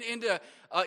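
ordinary delivery

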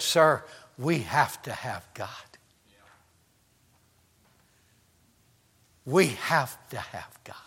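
low, very soft retold confession; hushed solemn reply. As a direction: say it softly, with long weighty pauses. Voices pastor's solemn reply.

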